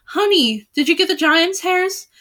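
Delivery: excited cry. A narrator's reading. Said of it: "cried the fair Melisande".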